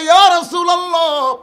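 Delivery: loud, high impassioned cry; high-pitched preacher's outcry. Acted. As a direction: shout it out as a desperate protest. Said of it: "চিৎকার করে বলে".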